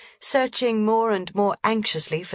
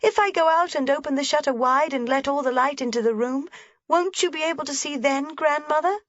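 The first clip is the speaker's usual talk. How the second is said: put-on lively little girl voice pitched high; a small girl pointing things out eagerly; young Heidi; 9 semitones higher, faster than usual. A narrator's reading. Heidi telling grandmother about the shutter, bright and eager.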